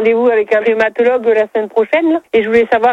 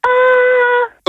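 squeaky, much higher ahh. Real, not acted. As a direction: shoot the ahh way up high. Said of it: "Maintenant, un A aigu".

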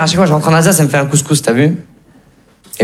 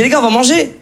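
mock friend; 7 semitones higher than usual. Imitating a friend.

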